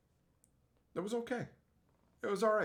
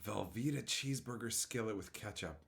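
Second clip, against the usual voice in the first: mock dismayed; 4 semitones lower, 9 dB quieter than usual. Rereads troubling chat message.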